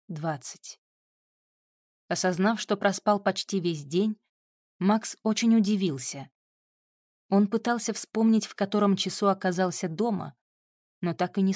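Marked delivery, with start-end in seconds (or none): none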